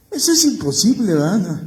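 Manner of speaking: high, loud disbelieving cry; indignant protest. Acted. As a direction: insist it could never happen. Peter insists he'd never deny.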